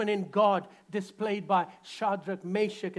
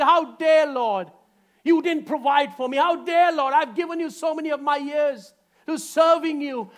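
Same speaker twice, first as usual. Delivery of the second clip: louder, higher, indignant; indignant complaint; 7 semitones higher, 7 dB louder than usual. Retold or imitated. A believer scolding God in mock indignation. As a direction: demand an answer from God. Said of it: "our attitude".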